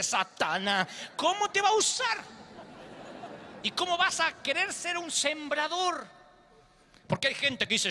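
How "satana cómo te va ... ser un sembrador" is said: mock voice of God. God scolds in acted quote.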